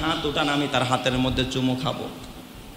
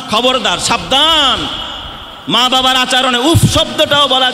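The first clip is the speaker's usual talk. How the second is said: high, loud sermon delivery; fiery waz preaching; preaching at full force; 10 semitones higher, 6 dB louder than usual. Acted. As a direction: thunder the verse at the crowd. Waz preacher quoting Quran forcefully.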